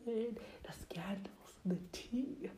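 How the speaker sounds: secretive whisper; slow, conspiratorial whisper. Acted. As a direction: lean in and whisper the juicy word. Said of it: "let's get to the topic".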